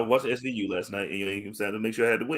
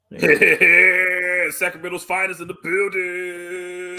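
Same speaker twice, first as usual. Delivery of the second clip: hype announcer; 6 semitones higher, 4 dB louder, much slower than usual. Pretend. Hyping up a wrestler's entrance.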